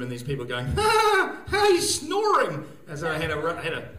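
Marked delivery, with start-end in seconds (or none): 0.7-2.7 high, loud, drawn-out jeering; mock audience laughter. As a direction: hoot it slowly, pointing someone out. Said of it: "there was a huge laugh".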